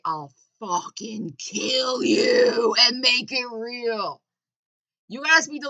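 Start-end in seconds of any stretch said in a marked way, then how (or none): 0.0-4.2 slow, louder, menacing threat; imitated threat. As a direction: spell it out slowly, like a prisoner warning a bully. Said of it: "come to them and say".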